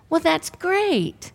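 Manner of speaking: slow, held-back "that's great"; composed reply. Retold or imitated. Woman hides her joy from the doctor, slow and calm. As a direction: keep the voice slow and even while bursting inside. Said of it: "And that was my voice".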